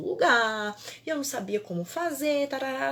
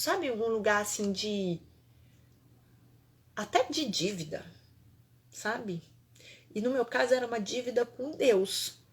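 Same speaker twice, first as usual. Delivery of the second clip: usual speaking voice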